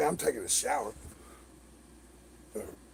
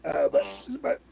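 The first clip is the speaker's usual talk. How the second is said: ordinary talk, nothing marked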